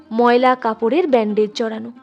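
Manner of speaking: usual speaking voice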